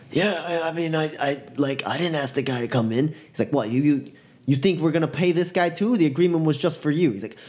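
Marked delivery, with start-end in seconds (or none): 0.1-3.1 high, stammering self-defence; flustered excuse. Acted. Roughed-up driver excusing himself, flustered. 3.5-7.1 high, fast, incredulous challenge; indignant confrontation. Acted. Thug challenging driver over payment, indignant.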